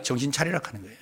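low, soft mock rebuke; dismissive. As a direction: brush off the excited news in a low voice. Sceptical disciples dismiss the news. (낮고 작게 핀잔주는 흉내)

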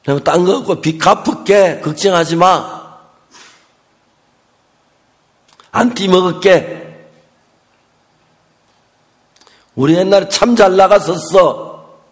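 loud, emphatic acted promise; retold defiant retort. Wife telling off the debt collector, loud and defiant. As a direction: push each phrase out loud and firm.

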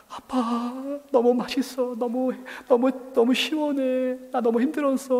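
high, drawn-out mock crying; imitated tearful child. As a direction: wail it slowly like an overwhelmed son. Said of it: "껴안고 막 울더라고요". (우는 아이를 흉내 낸 높은 울먹임)

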